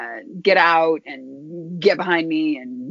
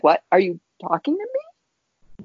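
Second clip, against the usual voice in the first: surprised retort; slower than usual. Retold or imitated. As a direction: play the caught-off-guard voice. Voice surprised at being addressed.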